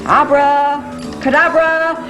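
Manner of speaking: stretched, showy abracadabra; slow drawn-out spell. Acted. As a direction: draw the spell out slowly. Magic word incantation.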